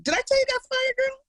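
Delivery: high, excited gossip. Real, not acted. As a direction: spill the news excitedly. Gossiping to friend.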